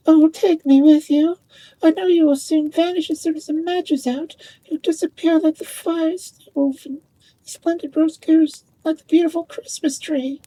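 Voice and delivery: put-on high, loud child's voice, pleading; pleading little girl. A narrator's reading. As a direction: beg grandmother to take her along. Child pleads with dead grandmother.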